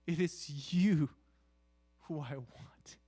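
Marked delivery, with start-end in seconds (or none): none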